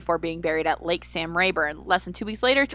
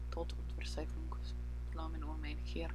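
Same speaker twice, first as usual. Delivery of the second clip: whispered German lyric; 3 semitones lower, 18 dB quieter than usual. Acted.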